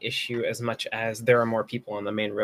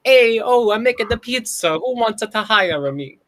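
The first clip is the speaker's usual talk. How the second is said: loud, high Italian pizza chef; Italian accent; 9 semitones higher, 6 dB louder than usual. Pretend. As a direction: shout it like a street pizzaiolo. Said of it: "in an Italian accent".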